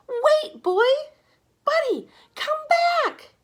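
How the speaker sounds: high, loud calling out; shouting. A narrator's reading. Boy shouts for his dog to come back, loud and high. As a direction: yell after the running dog. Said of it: "Sam shouted".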